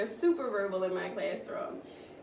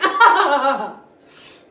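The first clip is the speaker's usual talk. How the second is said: loud real laugh bursting out; big burst of laughter; 4 semitones higher, 16 dB louder than usual. Real, not acted. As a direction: let the laugh explode. Laughing at kid story.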